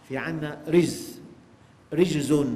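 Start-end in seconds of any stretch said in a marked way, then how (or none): 1.9-2.4 رجز with an i after the ر: rijzun; kasra on first letter. Shown as a demonstration. Preacher showing listeners the word's three vowellings, رجز with kasra, "rijzun". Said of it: "بالكسر والفتح والضم".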